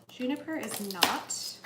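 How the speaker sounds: ordinary delivery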